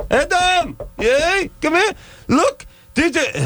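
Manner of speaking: neighbor woman calling. Retold or imitated. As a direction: squeal for Adam like a nosy neighbor.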